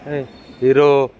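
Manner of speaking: slow, loud teasing call of hero; drawn-out loud call. Real, not acted. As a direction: stretch it out loud to tease the dog. Calling the dog teasingly. (कुत्र्याला चिडवत लांबवलेली मोठी हाक)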